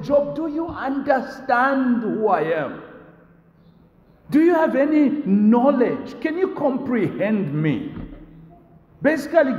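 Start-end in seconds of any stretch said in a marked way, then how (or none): 0.0-7.9 God's voice, higher and insistent; God questioning Job. Acted. God questioning Job about his knowledge, insistent and higher. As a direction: demand answers as the Creator. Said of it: "God is saying".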